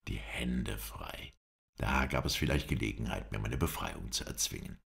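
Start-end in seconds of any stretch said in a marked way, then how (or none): 0.1-1.3 slow, low, near-whispered thought; hushed inner echo. A narrator's reading. Prisoner weighs his chance to escape, hushed and slow. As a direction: repeat it softly to yourself, drawn out.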